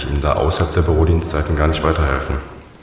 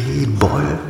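high, questioning echo to oneself; baffled echo; 8 semitones higher than usual. Acted. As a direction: repeat the odd word, utterly puzzled. Puzzled echo of caller's unknown word.

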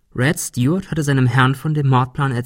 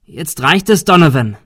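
raised, loud rebuke; angry outburst; 5 semitones higher, 6 dB louder than usual. A narrator's reading. Hero has had enough of Donovan, angry outburst. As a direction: burst out, loudest on the name. Confronting the gunman.

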